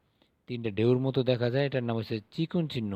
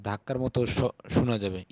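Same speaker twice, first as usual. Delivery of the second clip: ordinary talk, nothing marked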